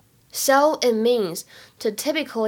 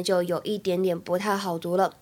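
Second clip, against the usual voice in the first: usual speaking voice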